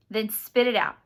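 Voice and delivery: loud, angry order; forceful demand. A narrator's reading. Agitated boy demands answer at knifepoint.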